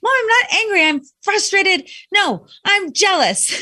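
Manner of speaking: high-pitched, emphatic mock protest; imitated angry daughter. Daughter corrects her mom about her feelings, mock-angry. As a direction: correct your mom, working up to the real word. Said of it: "She was angry about something".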